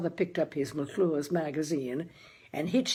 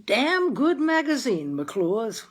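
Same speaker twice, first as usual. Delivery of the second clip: father's voice; 5 semitones higher, 5 dB louder, slower than usual. Acted. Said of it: "my father said".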